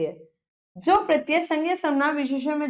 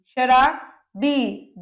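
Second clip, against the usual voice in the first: drawn out slowly; much slower than usual. Shown as a demonstration. Teacher dictating words to class.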